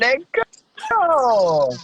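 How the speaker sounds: high, drawn-out "let's go"; hype cheer. Real, not acted. Host hyping the guest's testimony, excited cheer. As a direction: cheer them on with a long, high whoop.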